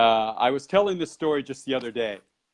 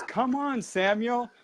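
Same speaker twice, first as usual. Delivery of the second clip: high, soft coaxing call; lightly mimicked call to follow; imitating beckoning companion; 9 semitones higher, 4 dB quieter, slower than usual. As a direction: urge him along in a light, high voice. Girl calling him deeper down.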